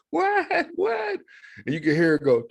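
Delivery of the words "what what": shrill mom on phone. Retold or imitated. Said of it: "You can hear on the phone".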